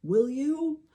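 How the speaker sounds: high, grateful question; tearful gratitude. A narrator's reading. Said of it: "deeply grateful for his help".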